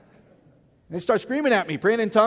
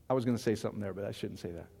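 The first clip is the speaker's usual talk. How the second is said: lower, much softer aside; quiet aside; 11 semitones lower, 11 dB quieter, faster than usual. Real, not acted. Pastor stops himself mid-sermon, quiet aside. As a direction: mutter it to yourself. Holding back a remark.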